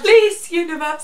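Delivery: high, loud plea; pleading fangirl. Real, not acted. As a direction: beg the universe, high and loud. Fan begging the universe.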